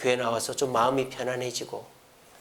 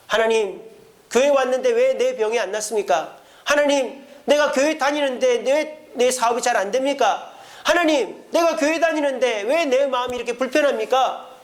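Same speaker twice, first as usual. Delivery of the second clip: anguished complaint to God; 8 semitones higher than usual. Pretend. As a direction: cry out to God demanding why. Believer protesting to God.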